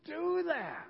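hammed-up appalled parent; mock stern dad. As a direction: play the shocked father setting rules. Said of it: "I'd be like".

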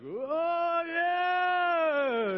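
high, loud tipsy cheer; drunk party goers. Retold or imitated. Drunk couple cheering before parasailing, high hammed-up whoop. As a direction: ham up a drunk sixty-year-old whooping. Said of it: "'cause they were like".

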